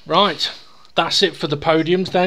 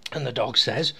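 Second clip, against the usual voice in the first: ordinary talk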